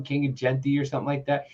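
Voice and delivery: ordinary talk, nothing marked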